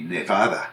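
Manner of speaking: NEVADA as neh-VAH-dah; Spanish vowels in Nevada, open AH in the middle; Spanish pronunciation. Shown as a demonstration. Showing the Spanish word meaning snowy, Nevada as neh-VAH-dah. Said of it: "to be a Spanish word".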